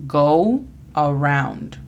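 "Go around" drawn out slowly, gou then araund; slow English go around. Shown as a demonstration. Teacher models "Go around" for students, slow careful English. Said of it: "La pronunciación fonética es G O U A R A U N D".

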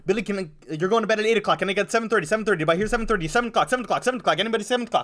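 fast, high auctioneer patter. Pretend. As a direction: rattle off bedtime bids like an auctioneer. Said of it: "she used to be an auctioneer".

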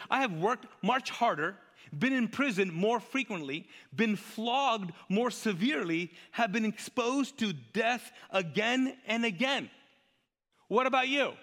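high, slow, emphatic list of grievances; Paul's rant. Acted. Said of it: "Paul on a rant".

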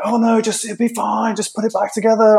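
high, breezy mock reassurance; chirpy helpers. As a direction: mimic well-meaning guests brushing it off. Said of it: "But they're like".

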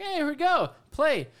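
high, gleeful launch cry; acted excitement. Acts out eager login before error.

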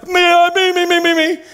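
the dad jumping in to grab the junk; eager bidding. Retold or imitated.

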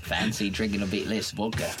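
slow, mock Australian judge; slow Australian magistrate scolding drinkers; Australian accent. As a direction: lay the Australian accent on thick. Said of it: "That's pretty good".

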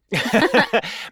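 a burst of high-pitched laughter; delighted laughing burst. Real, not acted. Laughs at the stepfather's reaction.

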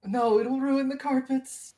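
high-pitched voice for a gnome mayor; fussy mayor. Acted. Gnome mayor objecting to the wyvern head, fussy. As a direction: fret over the carpet in a high character voice.

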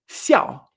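xiao started high and dropped hard; falling tone on xiao. Shown as a demonstration. Teacher shows the second syllable, xiao falling sharply. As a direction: fire xiao downward from the top.

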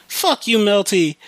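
high-pitched imitation of a taunting sister; mock sister's taunt. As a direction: jeer it in a mocking high voice.

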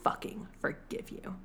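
low, quiet venom dropping into a whisper; hushed, venomous vow in a retelling. Retold or imitated. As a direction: whisper it with cold, acted spite. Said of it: "He's, like, venomous".

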